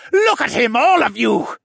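high, loud gloating cry; gloating villain. A narrator's reading. Villain shows off the wounded hero, gloating loudly. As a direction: crow over your fallen enemy. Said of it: "Vane gloated".

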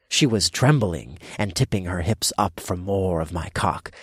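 plain reading voice

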